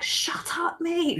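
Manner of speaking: mock whisper from under the bodies; whispered hush. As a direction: hiss it as if hiding. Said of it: "he's like".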